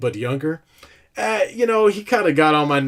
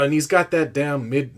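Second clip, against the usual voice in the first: ordinary delivery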